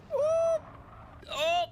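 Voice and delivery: high sudden yelp; startled yelp. Real, not acted. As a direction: yelp as if slipping. Nearly falls off fence.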